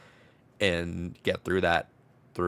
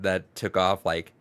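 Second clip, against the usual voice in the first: normal talking voice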